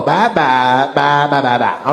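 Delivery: low, rhythmic "bye-bye, bye-bye-bye-bye"; "bye-bye", then "bye-bye-bye-bye" as even low syllables; cadence demo. Shown as a demonstration. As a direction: beat out "bye-bye, bye-bye-bye-bye" for a slow class. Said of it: "that's the cadence".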